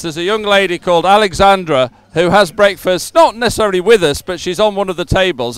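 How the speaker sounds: ordinary delivery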